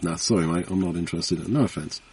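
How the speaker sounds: soft, low, dismissive brush-off; a hint of a bored householder waving off a salesman; imitating a householder. Householder turning a salesman away at the door, mild brush-off.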